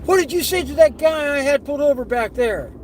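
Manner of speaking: high, forceful demand; mock an irate cop confronting someone; imitated screaming cop. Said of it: "the cop's screaming at him".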